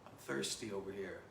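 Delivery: soft, feeble parched man; dying of thirst. Acted. Thirsty desert traveler begs tie sellers for water, soft and weak. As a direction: plead weakly, as if parched. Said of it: "He's dying of thirst".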